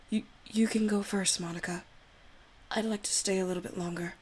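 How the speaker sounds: put-on soft, timid girl begging forgiveness; shy Yuri voice. Yuri begs Ari not to hate her, timid and pleading. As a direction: plead with him, nervous and apologetic. Yuri asks Monika to leave.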